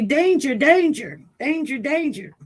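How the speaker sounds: high, loud imitation of a robot's warning; robot alarm. Imitating the robot from the Robinsons, alarmed. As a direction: cry out like a playful TV robot alert. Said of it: "that one robot in, in, uh, the Robinsons".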